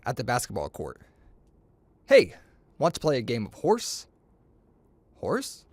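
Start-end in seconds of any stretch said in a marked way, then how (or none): none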